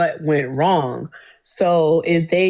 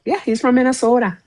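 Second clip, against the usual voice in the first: high-pitched mock Minnesota accent; 7 semitones higher than usual. Co-host confirming where he's from, mock Minnesota accent. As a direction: put on a playful Minnesota accent.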